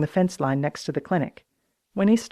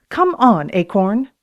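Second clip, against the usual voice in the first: loud, stern dictator order; Stalinesque command; 4 semitones higher, 7 dB louder, slower than usual. A narrator's reading. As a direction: bark it like a tyrant giving orders.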